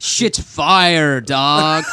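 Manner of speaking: loud laid-back American slang; a hammed-up American bro; American accent. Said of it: "his best one's like".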